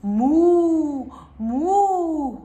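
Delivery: loud, long drawn-out moo; mock cow moo. Host answers what cows say, loud playful moo. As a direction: moo like a big cow, then laugh. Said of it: "What do vacas say?".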